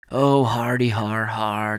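slow, loud, drawn-out mock laughter; scornful, spelled-out laughing; sarcastic fake laugh. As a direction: drag out the unimpressed ha-ha. Friend sarcastically dismisses the scare.

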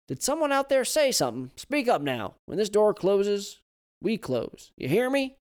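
put-on high, loud grumbling old Southern man. Old man complains to his wife about the locks, loud and high.

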